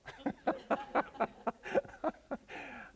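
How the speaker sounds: a long, high, breathy laugh. Real, not acted. Storyteller amused by listeners' disbelief, a long high laugh. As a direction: let a laugh run out high. Laughs after telling reaction.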